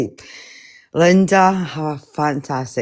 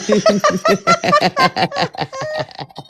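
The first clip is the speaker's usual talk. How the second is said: long high-pitched burst of laughter; real helpless giggling fit; laughing. Real, not acted. Banter between friends on live.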